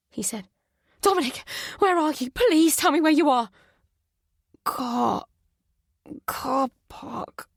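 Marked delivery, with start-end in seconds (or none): 1.0-3.5 high, urgent, loud begging; frantic pleading. Acted. Mother begging her injured son on the phone, frantic. 4.6-7.5 thick, confused voice. Acted. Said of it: "His voice was thick and confused".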